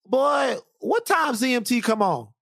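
dad asking when his show comes on, high and eager; imitating dad. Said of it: "my dad called T M Z Z M T".

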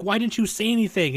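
high-pitched worried question, retold; all concerned. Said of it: "getting all concerned and shit".

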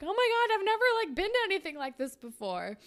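breathless delighted gush; thrilled. Acted. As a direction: squeal it like a girl at her first street race. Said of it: "And you're like".

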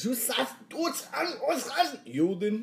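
high-pitched, strained mock German; fake German attempt. Shown as a demonstration. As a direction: try too hard at German gibberish. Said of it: "let me hear yours".

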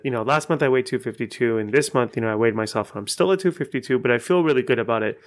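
quick natural talk; just rattle it off; fast off-the-cuff talking. Shown as a demonstration. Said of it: "That's how fast I talk".

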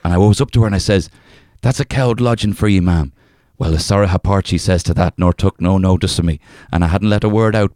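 reading a folk tale in a lightly put-on Irish accent. Reading an old folk tale to a co-host, in a light Irish accent. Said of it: "can I just say that accent".

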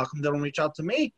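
normal talking voice

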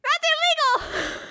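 very high, squealed objection; acted shocked protest. Law-abiding child objects to dad, squealing in shock.

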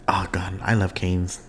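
a soft whisper under the breath; hushed, wistful whisper. Real, not acted. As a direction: whisper it to yourself, longing for the food. Craving Cane's chicken.

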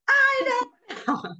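very high, drawn-out mock wail; wailed outburst. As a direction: reenact a patient shrieking in exasperation. Acts out pained patient snapping back.

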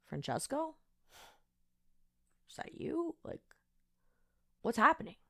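soft, awed question to the cat; quietly, as if seeing a ghost; hushed disbelief. Retold or imitated. Asking the cat if it's her lost Francesco, soft and awed. Staring at cat, feeling chills.

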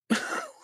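a short high burst of laughter. Real, not acted. Guest laughing to the host about the stranger, high laugh. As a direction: laugh at how unreal it seemed.

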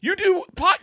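husband acts out wife yelling, high-pitched; mock wife, shouting. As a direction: shriek the accusation as the wife. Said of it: "She's like".

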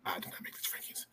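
quick whispered aside. Real, not acted. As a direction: whisper it fast under breath.

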